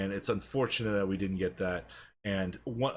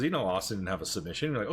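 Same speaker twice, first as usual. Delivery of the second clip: ordinary talk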